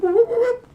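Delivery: high, loud whooping whip noise; cartoon whip noises; whip sound effect. Imitating power whip sound.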